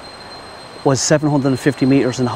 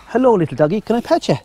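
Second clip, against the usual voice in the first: talking to a dog; 8 semitones higher, faster than usual. Real, not acted. Greeting a friendly dog.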